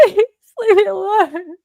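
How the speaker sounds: high, loud, laughing mock plea; mock terrified plea. Mocking a scared victim, high and loud begging. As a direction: squeal it like a fake victim begging.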